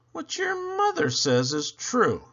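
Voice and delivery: lightly hammed-up dad at dinner. A narrator's reading. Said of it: "Dad helps himself to some potatoes and says".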